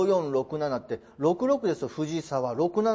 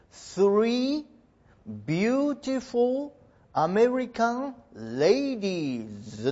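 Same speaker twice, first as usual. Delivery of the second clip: example sentence read slowly and carefully, word by word; slow model reading; 4 semitones higher, much slower than usual. Shown as a demonstration. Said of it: "こういう言い方". (ゆっくり丁寧に例文を読み上げる)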